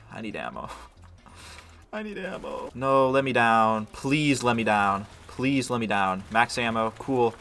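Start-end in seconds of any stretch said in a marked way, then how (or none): 1.3-2.7 unvoiced, breathy whisper with a laugh; a much softer, hushed repeat. Real, not acted. Same line repeated whispered.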